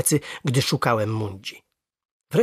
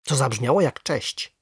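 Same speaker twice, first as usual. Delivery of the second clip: usual speaking voice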